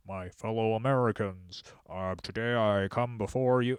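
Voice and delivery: lightly mocking a stiff politician's formal opening; a hint of stuffy podium speech, half-hearted; stiff career politician. Trump mocking a stiff career politician at his rally, lightly hammed-up formal address. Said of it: "acting like a stiff Biden-like politician".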